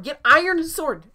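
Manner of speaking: loud, high, drawn-out yell; big high-pitched gamer holler; excited game shout. Real, not acted. Calling out excitedly mid-game.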